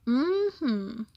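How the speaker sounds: ordinary delivery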